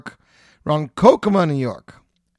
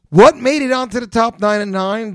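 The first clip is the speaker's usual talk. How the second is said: radio host hype; 8 semitones higher, 7 dB louder than usual. Acted.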